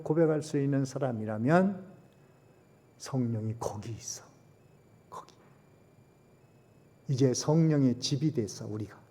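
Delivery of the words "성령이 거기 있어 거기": hushed unvoiced whisper, lower; whispering to the congregation that the Spirit is there. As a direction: breathe it like a secret. Preacher whispers a secret truth.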